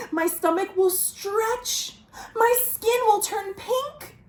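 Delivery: frightened crocodile. Acted. Croc fretting to himself about vines growing, high whimpering panic. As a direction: whimper each fear bigger.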